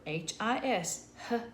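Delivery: ordinary talk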